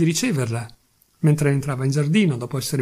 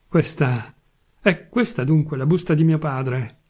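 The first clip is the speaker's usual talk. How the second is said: ordinary delivery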